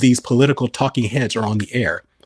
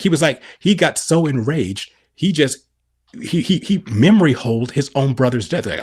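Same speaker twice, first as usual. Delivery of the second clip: ordinary talk, nothing marked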